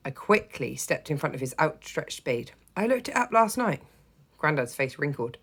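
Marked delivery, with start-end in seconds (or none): 2.8-3.8 put-on high eager child. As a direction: voice the eager kid. Child character voice.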